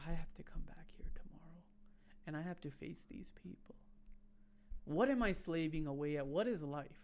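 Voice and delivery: despairing whisper, hushed. Retold or imitated. Going home rejected, whispering inner despair. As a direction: mutter it to yourself in despair.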